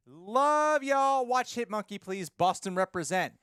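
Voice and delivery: high and loud, hyped; excited read. Acted. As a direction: read it like a hype shout. Reading a super chat aloud.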